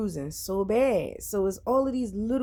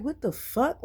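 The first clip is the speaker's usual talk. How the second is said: retold alarm, pitched higher; shocked what the fuck; 8 semitones higher than usual. Retelling her nosebleed scare, in high disbelief. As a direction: replay her stunned outburst, high.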